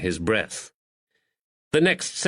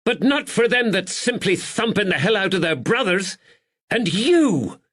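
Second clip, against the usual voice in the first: high, loud scolding yell; 10 semitones higher, 3 dB louder than usual. Acted. Landlady yells at the policeman brother. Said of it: "she yelled".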